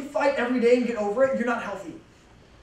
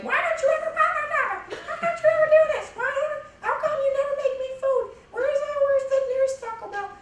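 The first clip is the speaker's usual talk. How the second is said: much higher, whiny nagging woman's voice; mock nagging girlfriend, pitched way up. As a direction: complain at him in a high nagging voice. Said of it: "dragging behind is this woman that's like".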